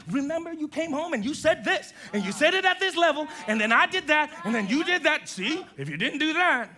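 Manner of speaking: high, loud, accusing replay; heated argument reenactment. Acted. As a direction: act out relitigating a fight point by point. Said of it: "I would reenact the whole thing".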